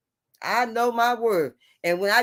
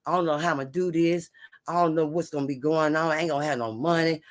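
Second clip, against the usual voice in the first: ordinary talk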